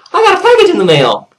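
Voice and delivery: very high, loud squeal of an announcement; super excited. Real, not acted. Vlogger announcing a long-awaited package, super excited. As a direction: burst with glee.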